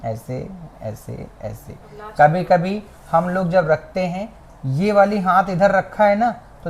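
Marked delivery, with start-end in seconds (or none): 0.1-1.8 softer, slow ऐसे, ऐसे while pressing lightly; slow soft demo murmur. Shown as a demonstration. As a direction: show the light touch with your voice. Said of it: "ज़्यादा तेज़ ना दबाना, हल्का सा".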